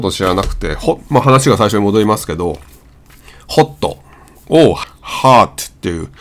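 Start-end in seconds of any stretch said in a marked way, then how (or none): 4.7-5.5 ハート as English hot, long open 'ah'; American hot, vowel near 'ah'; American pronunciation. Shown as a demonstration. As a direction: say ハート as 'haaht' with a light t. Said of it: "あに近いんだよ".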